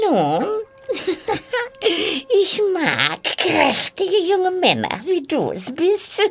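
high witch voice broken by giggles; cackling, flirtatious witch.

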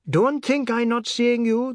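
high-pitched, loud outraged yell; indignant shout. A narrator's reading. Boy below to brother-in-law upstairs, indignant shout. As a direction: shout a threat up to the window. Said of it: "the god shouted".

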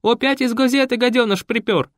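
railing. Acted. Raisa rails against the reporter, high and loud. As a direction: spit out the insult.

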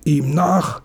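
high, urgent exclamation; crying out. A narrator's reading. Bank manager orders everyone after the thief, crying out. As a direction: send them chasing with a high cry. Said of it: "schrie der Bankvorsteher".

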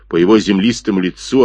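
ordinary delivery, nothing marked

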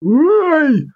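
high yelp through a mask; muffled yelp. Acted. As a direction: cry out behind a mask. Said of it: "донеслось из-под маски".

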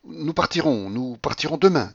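high, sudden resolve; sudden excitement. A narrator's reading. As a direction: blurt it out eagerly. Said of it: "dit-elle tout à coup".

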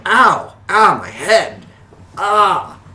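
high, loud cries of hurt; crying out in pain. Acted. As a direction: yelp like someone who just cracked his head. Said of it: "some guy sort of crying out".

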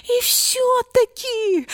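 very high, slow squeaky protest; tiny mouse objecting. A narrator's reading. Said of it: "возразила мышка".

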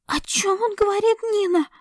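lost and scared; frightened young woman. A narrator's reading.

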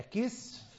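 كسف said "kisf", no vowel after the s; كسف as one syllable, kisf; singular form, sukun. Shown as a demonstration. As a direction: run s into f in كسف. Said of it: "المفرد".

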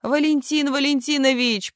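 high, theatrical appeal; pleading old woman. A narrator's reading. Old actress reaching out to the troupe manager, pleading. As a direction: call to him as a rescuer. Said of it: "протянула к нему руки старуха".

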